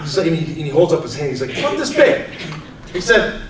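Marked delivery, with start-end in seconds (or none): 1.6-2.3 grandpa showing size. Retold or imitated.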